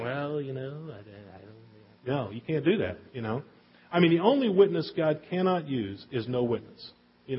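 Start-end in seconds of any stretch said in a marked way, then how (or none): 0.0-2.1 hesitant mumble. Pretend. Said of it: "you can't just walk around".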